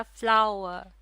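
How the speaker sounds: "Flower" loud, said as one unbroken beat; word in one piece. Shown as a demonstration. Teacher showing the word is never broken up, word in one piece. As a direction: say "flower" all at once, firmly. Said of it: "इसको तोड़ा नहीं जाता".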